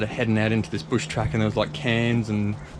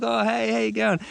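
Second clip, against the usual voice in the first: much higher, strained mock-cheery hello; mimicking their greeting; 12 semitones higher than usual. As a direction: fake a cheerful hello while hiding disappointment. Mates' greeting, mimicked, hiding disappointment.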